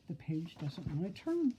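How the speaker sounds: ordinary delivery, nothing marked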